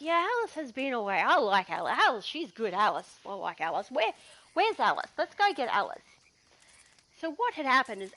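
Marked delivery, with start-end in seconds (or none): 0.0-6.1 loose, rambling tipsy praise, sometimes loud; drunk bushranger. Retold or imitated. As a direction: play the merry drunk host. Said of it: "And he's like".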